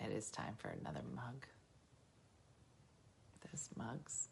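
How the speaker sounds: unvoiced aside whisper. Real, not acted. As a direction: breathe it as a secret.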